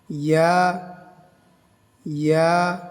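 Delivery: ইয়া held one alif, half sung; short chanted ইয়া; Arabic letter ya. Shown as a demonstration. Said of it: "এক আলিফ টান হবে".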